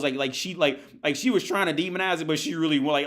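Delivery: ordinary talk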